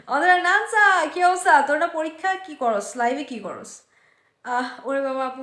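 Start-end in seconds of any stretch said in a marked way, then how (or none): 0.1-1.6 higher and louder, a happy call to a friend; excited greeting. Real, not acted. Teacher greeting her student Nansa, loud and excited. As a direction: call out her name with delight.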